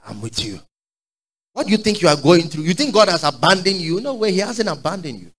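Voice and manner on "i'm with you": soft whisper-like promise. Real, not acted. Quoting God tenderly.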